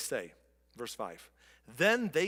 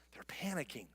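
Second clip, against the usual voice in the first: 1 whispered aside; 11 dB quieter than usual. A narrator's reading. Tense retelling of frightened people.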